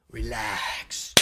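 soft, low coaxing relax; soothing barber. Retold or imitated. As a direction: lull the client like a Turkish barber. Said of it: "They're like".

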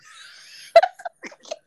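high shrieking burst of laughter; screaming laugh. Real, not acted. Cracking up over the show's funniest scene, shrieking laugh. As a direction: squeal with helpless laughter. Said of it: "I screamed out loud".

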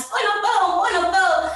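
grito imitado, agudo e um pouco mais alto; imitating a shout. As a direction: gerente italiano gritando com os funcionários. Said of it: "gritando com os funcionários". (imitação de gerente gritando, agudo)

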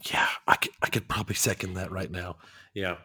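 soft hushed agreement; whisper. Real, not acted. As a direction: whisper back in on the joke. Whispered reply to co-host's aside.

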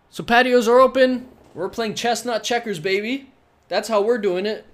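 loud, high, pumped-up boast; hyped shout. Real, not acted. Podcaster hyping his listeners, loud and pumped up. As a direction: crow it out like a victory cry.

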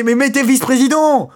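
high, loud indignant shout; exasperated outburst. Real, not acted. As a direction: yell the fix at them, fed up. Ranting at job titles.

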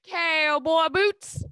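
high, loud, slow Southern drawl; exaggerated Southern twang, vowels dragged out; best Southern accent. Shown as a demonstration. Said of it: "do your best Southern accent".

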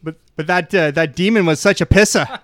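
put-on loud, broad Boston accent. Doctor Strange recounting a fight with a demon, broad Boston accent. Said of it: "I now want the Boston Doctor Strange".

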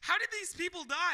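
high-pitched incredulous question; mock-bewildered crowd voice; baffled onlookers. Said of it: "So people are like".

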